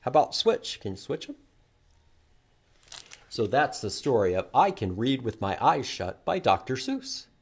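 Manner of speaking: ordinary talk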